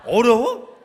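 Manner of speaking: loud, high, laughing taunt; mocking challenge. Zealot taunting a young believer about martyrdom, laughing loud. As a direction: sneer the question with a laugh. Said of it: "라는 식으로 완장질로 여러 조졌습니다".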